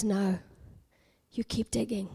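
low, unhurried divine refusal; voice of God. Retold or imitated. God refuses the protesting digger, low and calm. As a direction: answer as God, quiet and low. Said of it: "The Lord says".

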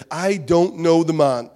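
slow, loud furious denial; loud slow sworn denial; angry oath. Acted. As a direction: spit out the denial with a curse. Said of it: "call down curses, and he swore".

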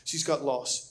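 ordinary talk

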